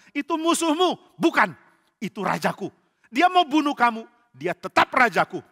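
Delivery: high, loud back-and-forth protest; acted heated argument. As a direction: argue it out forcefully. Acted argument, soldier and David.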